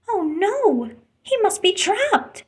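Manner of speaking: high, alarmed worry; a rush of concern for the dolphin; worried. A narrator's reading. Ariel alarmed at finding the trapped dolphin.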